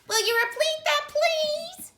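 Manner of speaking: very high, loud clerk voice; clerk on the phone. Acted. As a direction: chirp it like a prim clerk. City Hall voice on phone.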